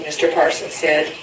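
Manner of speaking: ordinary delivery, nothing marked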